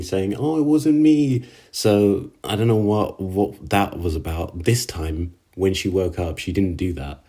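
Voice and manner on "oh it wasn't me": a higher, louder mock scream of denial. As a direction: squeal the denial, half in jest. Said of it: "she's usually screaming".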